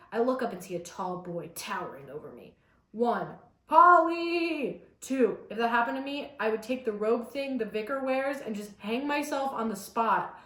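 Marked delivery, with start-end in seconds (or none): 3.7-4.8 called out. Acted. Calls out name in reading.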